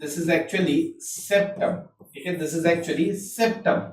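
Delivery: slow, soft dictation of the label. Shown as a demonstration. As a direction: dictate it slowly for writing. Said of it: "ये क्या है?".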